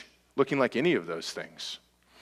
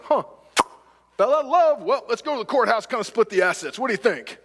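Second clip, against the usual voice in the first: higher, breezy voice of a spouse shrugging off the marriage; mock casual spouse; 11 semitones higher, 5 dB louder than usual. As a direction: play it like it's no big deal. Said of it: "waking up one day like".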